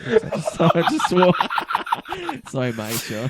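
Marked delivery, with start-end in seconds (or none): 0.0-2.5 high laughter breaking through; laughing. Real, not acted. Guest on radio, laughing after swearing live. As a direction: laugh at himself for swearing on air.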